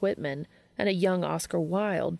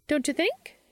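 high, playful nudge; eager teasing; 9 semitones higher than usual. Acted. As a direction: prod him with a grin. Charlie teasing Dean.

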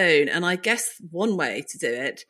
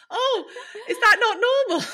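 much higher, laughing through the words; laughing mock surprise. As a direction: play innocent while laughing. Her own retold reply, laughing.

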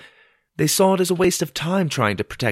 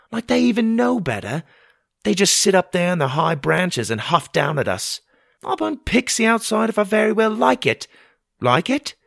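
much higher, louder defiant mother; indignant Ma; 9 semitones higher, 4 dB louder than usual. A narrator's reading. Mother scoffs at the royals' rules, defiantly. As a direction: huff and bristle against royalty.

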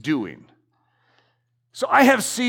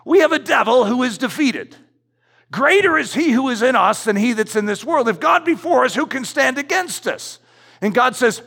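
preaching at full force; 5 semitones higher than usual. Acted. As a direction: build the repeated knocking like a revival preacher.

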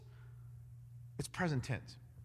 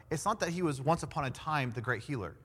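ordinary delivery

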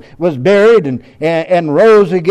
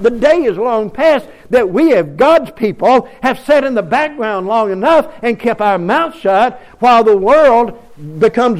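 raised, rhythmic sermon build; fiery preaching; 4 semitones higher than usual. Acted. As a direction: preach it to the congregation with force. Preaching at full force.

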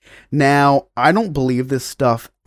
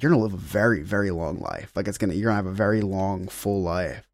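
solemn medium; 5 semitones lower, 7 dB quieter than usual. Retold or imitated. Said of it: "She's like".

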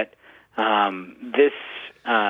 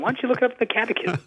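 laughing; 12 semitones higher, much faster than usual. Retold or imitated. Mocking his students, laughing.

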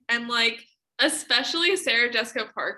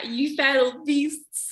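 slow, dragged-out fake British accent; mock British accent; 3 semitones higher, much slower than usual. Echoing her co-host to mock the actor, slow British accent. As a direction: stretch each word in an overdone British accent. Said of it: "why are you trying so hard?".

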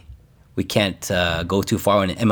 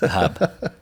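"The Hub" laughed out higher in a broad Boston accent; laughing Boston accent; 8 semitones higher than usual. Shown as a demonstration. Laughing at his own Boston accent, he repeats it. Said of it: "say these in a Boston accent".

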